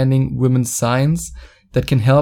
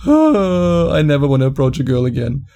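whiny rejected guy. Retold or imitated. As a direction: moan it like a self-pitying loser. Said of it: "you say".

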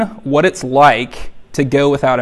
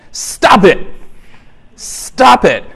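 sudden blunt yell; 5 semitones higher, much slower than usual. Retold or imitated. Therapist in a comedy skit giving his two-word cure, yelled. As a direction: burst out high, pitch dropping hard at the end.

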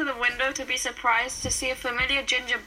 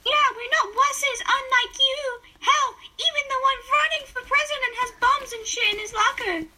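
high cocky voice; high character voice; 10 semitones higher than usual. Acted. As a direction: taunt in a squeaky voice.

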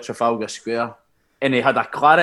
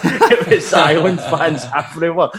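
laughing through the words, a little higher and louder; 6 semitones higher, 5 dB louder than usual. Real, not acted. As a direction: let the laugh break into the line. Telling a funny away-day story.

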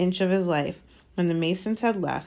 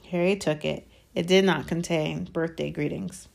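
usual speaking voice

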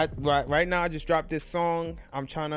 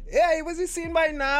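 much higher and louder, full Trini accent; Trinidadian accent; 13 semitones higher, 6 dB louder than usual. Shown as a demonstration. Showing how he talks to other Trinis, in a Trinidadian accent. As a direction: switch into his Trinidadian accent, pitched up. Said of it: "But if I'm talking to anybody else, I'm".

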